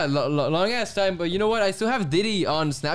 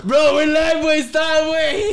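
urgent shout; 9 semitones higher, 5 dB louder, much slower than usual. Real, not acted. Friend warns about live stream.

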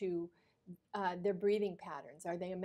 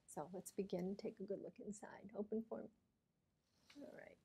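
whispering; 9 dB quieter than usual. Real, not acted. Doctor quietly instructs patient.

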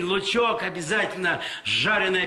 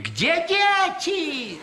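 very high, drawn-out panicked cry; frantic shriek; 13 semitones higher, much slower than usual. Retold or imitated. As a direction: scream it like a mother who lost her kids. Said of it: "истошный крик".

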